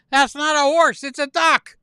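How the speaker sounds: loud, high, indignant wife; acted outburst, pitched high and loud; exasperated correction. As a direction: correct him at full volume. Wife sharply corrects husband in joke.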